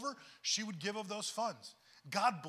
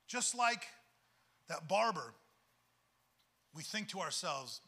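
ordinary talk, nothing marked

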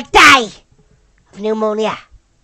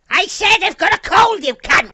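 raised, exasperated retort; irritated shout; 5 semitones higher, 4 dB louder than usual. Real, not acted. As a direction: snap it back at someone who keeps teasing. Irritated repeat to teasing teammate.

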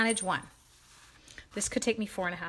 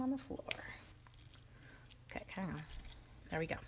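whisper; 4 semitones lower, 15 dB quieter, faster than usual. Real, not acted. Secretly tossing scraps on floor.

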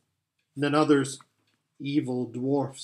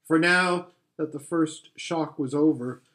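ordinary delivery

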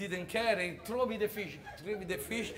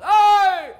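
high, very loud shout from the back; loud call; 12 semitones higher, 18 dB louder, much slower than usual. Acted. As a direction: yell it across the whole crowd. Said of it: "a voice rang out loud".

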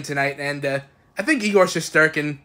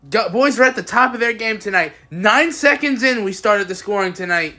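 usual speaking voice

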